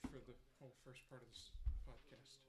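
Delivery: ordinary delivery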